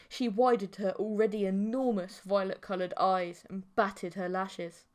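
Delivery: plain reading voice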